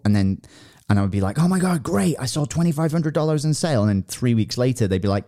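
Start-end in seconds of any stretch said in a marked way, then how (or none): 1.4-3.8 high-pitched retold delight; excited retelling. Retelling her first sale, excited and high. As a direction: act out happy news, pitched up.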